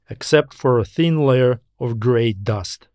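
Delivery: plain reading voice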